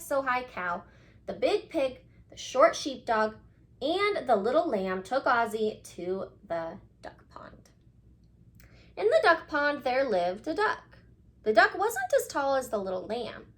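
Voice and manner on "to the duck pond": hushed storybook whisper; whispered narration. A narrator's reading. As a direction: breathe the line out like a secret.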